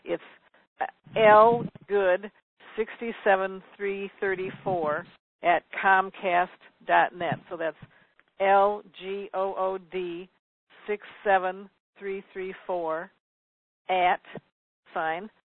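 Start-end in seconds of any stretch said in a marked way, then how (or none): 1.2-7.4 slow, deliberate dictation; measured pace, group by group; slowly. Real, not acted. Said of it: "I'm gonna say it slowly". 8.4-15.3 slow, letter by letter; careful, deliberate spelling; slowly spelled out. Real, not acted. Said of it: "I'm gonna say it slowly".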